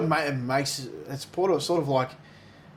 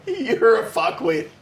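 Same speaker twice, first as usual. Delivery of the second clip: laughing insult; 7 semitones higher, 6 dB louder, much slower than usual. Real, not acted. Mock insult while laughing.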